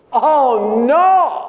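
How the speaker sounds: the shock of realising the mistake; father crying out in sudden dismay. Acted. Isaac suddenly comes to.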